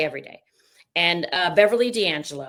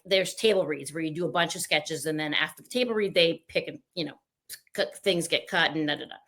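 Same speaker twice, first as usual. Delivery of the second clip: usual speaking voice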